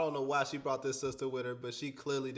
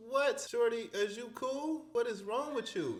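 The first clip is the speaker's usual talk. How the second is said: baffled disbelief; 8 semitones higher than usual. Real, not acted. Host baffled by the story.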